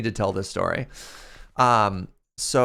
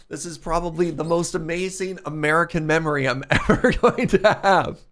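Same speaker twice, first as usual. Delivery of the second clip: higher voice breaking into laughter; laughing; 5 semitones higher, 3 dB louder than usual. Real, not acted. Streamer recalling the Englishman's Fourth of July speech, laughing while talking. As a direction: laugh through the end of the sentence.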